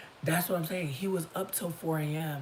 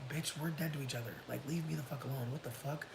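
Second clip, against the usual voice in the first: softer, fast, cold brush-off; quiet dismissal; 9 dB quieter than usual. Retold or imitated. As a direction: mutter it to shut him down. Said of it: "I'm like".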